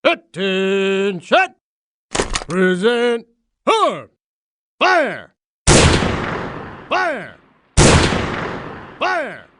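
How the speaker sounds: sharp drill commands; military commands. Acted. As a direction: bark the orders, high and clipped. Honour guard commands at funeral.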